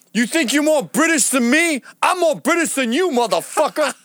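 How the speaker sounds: high, loud hammed-up rant; imitating angry guy. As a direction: bark it like a belligerent stranger. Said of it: "And he goes".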